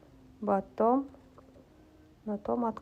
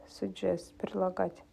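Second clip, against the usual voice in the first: ordinary talk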